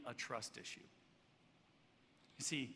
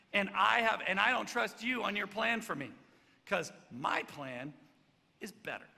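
loud, higher, self-assured; one-up him proudly; cocky Peter, raised voice; boastful Peter; 4 semitones higher, 8 dB louder than usual. Retold or imitated. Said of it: "He goes".

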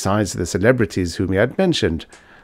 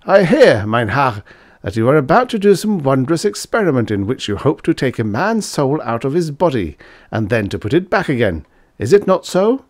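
Fritz, higher and louder, pressing his master; bold young student; 6 semitones higher, 4 dB louder than usual. Acted. Student confronting the professor about the experiment, bold and loud. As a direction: challenge him, half teasing.

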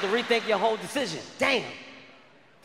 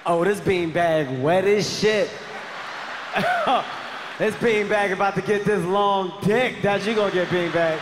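loud beanbag boast; 3 dB louder, slower than usual. Retold or imitated. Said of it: "I was like".

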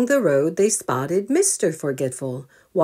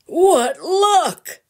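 high, loud happy cry; delighted exclamation; 12 semitones higher, 6 dB louder than usual. Acted. Grandpa finding the family car, high delighted cry. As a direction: cry out at the lucky find.

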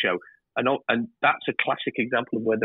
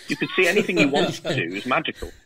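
higher and laughing as you say it; 4 semitones higher than usual. Real, not acted. Director jokes about what the window shows, laughing. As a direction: laugh at the absurdity of the shot. Joking about the impossible view.

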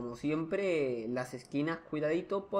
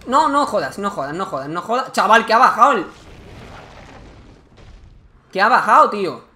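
loud, panicky exclamations; can't believe what just happened; shocked disbelief; 4 semitones higher, 14 dB louder than usual. Real, not acted. Reacting to enemy descending.

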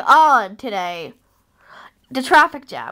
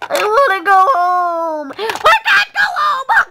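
wailing; 7 semitones higher than usual. Pretend. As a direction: wail like a fed-up traveler. Pretend despair in the jam.

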